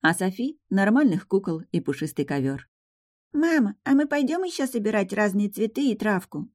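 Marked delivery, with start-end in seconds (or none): 3.3-6.5 put-on higher, eager little girl asking. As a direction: ask like a small child. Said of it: "спросила малышка".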